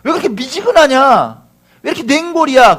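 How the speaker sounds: acted urgent rebuke, pitch riding high; exasperated exhortation. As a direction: chide them as if quoting a frustrated master. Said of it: "예수님 말씀하시잖아요".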